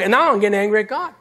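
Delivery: ordinary talk, nothing marked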